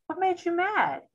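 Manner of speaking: parent revisiting the tantrum calmly; gentle parent check-in. Retold or imitated. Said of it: "be like".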